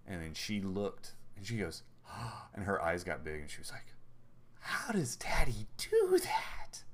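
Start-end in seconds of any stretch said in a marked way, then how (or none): 1.9-2.5 imitated child's gasp of wonder; sharp amazed intake of breath; astonished gasp. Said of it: "like, astonishment". 4.6-6.8 slow, high, breathy whisper of wonder; drawn-out whispered amazement, pitched way up; astonished child. Retold or imitated. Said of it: "that kind of, like, astonishment".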